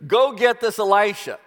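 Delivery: enraged command to seize Elisha; in a rage. Retold or imitated. King orders his men to seize Elisha, enraged. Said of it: "in a rage now".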